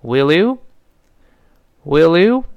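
"will you" slowly joined as will-lyou, rising; linked sounds. Shown as a demonstration. Teacher repeats will you for learners, slowly linked. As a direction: slide "will" into "you", lifting upward. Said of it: "要连读的".

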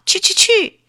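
high, loud mocking replay of the shoo; boy retelling his own yell to grandma; imitating his shout. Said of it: "他装腔装调的也说".

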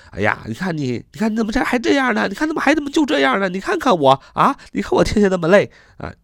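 high-pitched, fast, nagging grumble; whiny complaining. Shown as a demonstration. Teacher acts out a grumbler to show the word, high whiny complaint. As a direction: moan like someone fed up and exhausted. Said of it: "抱怨啊".

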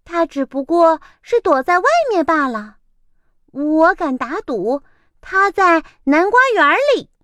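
高而快的嘲笑腔; 猫满不在乎地打赌; sneering cat. Acted. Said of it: "猫带着嘲笑的口气说". (猫嘲笑着说鸭子躲起来了)

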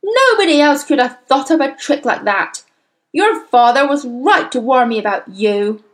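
loud, angry accusation; heated, loud tirade; out-of-control rage. A narrator's reading. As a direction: rant at the pupil as a furious headmistress. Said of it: "seemed to have lost control of herself completely".

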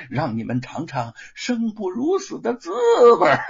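villain relishing a threat; shameless gloating. A narrator's reading. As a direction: taunt them with mock deference. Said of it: "显着脸笑了笑".

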